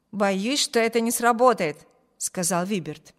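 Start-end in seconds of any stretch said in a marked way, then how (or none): none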